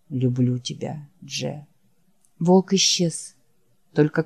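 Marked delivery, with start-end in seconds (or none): 0.1-1.7 low, soft, worn-out creature voice; barely has the strength to say the name; weary dying Wolf. Acted. Wounded Wolf calling his friend Jack, low and faint. Wolf's voice.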